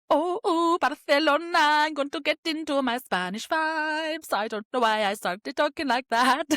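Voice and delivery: high, loud, hammed-up Spanish accent; muddled mock-Spanish accent drifting towards Indian and Polish; bad Spanish accent. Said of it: "That is a very bad accent".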